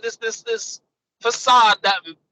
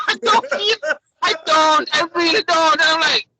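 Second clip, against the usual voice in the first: usual speaking voice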